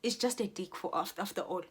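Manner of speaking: ordinary talk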